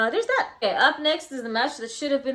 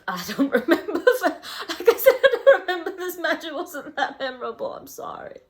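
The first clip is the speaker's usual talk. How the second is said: higher and louder, laughing through the words; 8 semitones higher, 5 dB louder than usual. Real, not acted. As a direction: giggle while admitting you forgot. Laughing at own forgetfulness.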